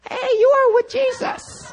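put-on very high, slow girlish squeal; little servant girl. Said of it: "a little servant girl".

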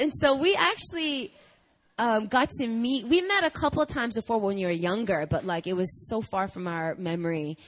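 normal talking voice

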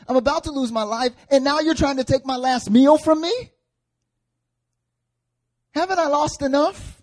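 acted outburst of grief and indignation; desperate protest. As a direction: beg to be left alone, pitch climbing high. Acts out widow's desperate plea to Elijah.

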